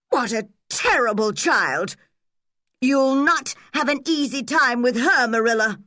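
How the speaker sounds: indignant neighbour. A narrator's reading. Shocked neighbour scolds.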